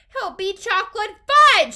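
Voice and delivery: high, very loud scream. A narrator's reading. Mother contradicts Wonka about her son, screamed. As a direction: scream it in horror. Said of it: "screamed Missus Gloop".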